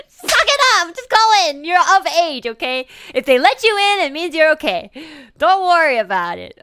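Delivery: loud, high, exasperated rant; mock scolding. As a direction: yell at the whiny kid to toughen up. Mock scolding the whiny cartoon child.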